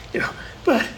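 fighting back tears mid-sentence; real emotion, words nearly gone; choked up. Real, not acted. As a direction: voice catching, barely voiced. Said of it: "getting all choked up here".

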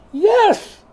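a very high, loud, sulky yes; stubborn defiant yes. Acted. Jonah answering God, high petulant yes. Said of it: "And he said".

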